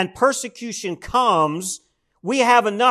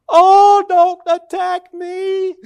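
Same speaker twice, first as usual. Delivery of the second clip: high, slow mocking whine of a wounded gossip; mock whiny victim; 13 semitones higher, 4 dB louder, much slower than usual. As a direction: parody the offended busybody, high and dragged out.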